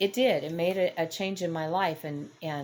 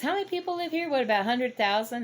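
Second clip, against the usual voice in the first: a bit higher, musing question; lightly voiced dad wondering aloud; dad's voice; 8 semitones higher, faster than usual.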